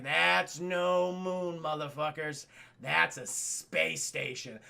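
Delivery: slow, low ominous pronouncement; Ben Kenobi. Retold or imitated. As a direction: draw it out with mock gravity. Retold Ben's ominous reveal.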